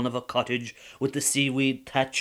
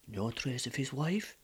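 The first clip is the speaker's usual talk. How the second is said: usual speaking voice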